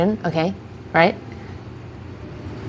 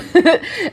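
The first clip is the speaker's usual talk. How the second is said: short real laugh; 10 semitones higher than usual. Real, not acted. Laughing at her own mix-up.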